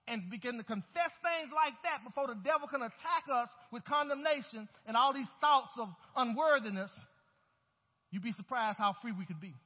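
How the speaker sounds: ordinary talk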